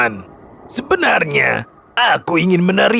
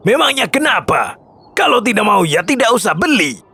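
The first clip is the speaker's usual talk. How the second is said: ketus; 4 semitones lower than usual. Acted. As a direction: brush off the complaining buyer. Penjual menepis protes pembeli. (penjual ketus, tak peduli)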